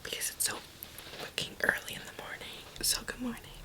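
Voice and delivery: whispering so the house sleeps; hushed early-morning whisper. Real, not acted. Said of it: "I have to whisper right now because everyone in my house is sleeping".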